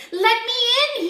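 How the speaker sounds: high, loud howl. A narrator's reading. Big Bad Wolf banging at Missus Duck's door, howling. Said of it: "he howled".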